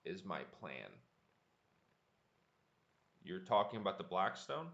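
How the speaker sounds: ordinary talk, nothing marked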